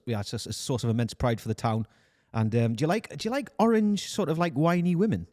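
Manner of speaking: hesitant, rambling Geordie sales pitch; Newcastle accent. Acted. Recruiter selling the town to an agent, Newcastle accent. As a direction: put on a chatty Geordie accent. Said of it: "Newcastle accent than this".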